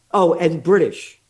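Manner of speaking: ordinary talk, nothing marked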